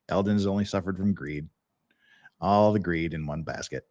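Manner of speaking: low, soft deadpan Kurt voice; Kurt impression. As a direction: drop low and dry like Kurt. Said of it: "that's my best Kurt impression".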